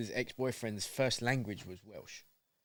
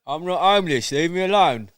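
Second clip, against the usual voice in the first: louder, higher indignant protest; mock protest; 4 semitones higher, 11 dB louder than usual. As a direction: protest loudly that you're fine. Voicing weathered brother.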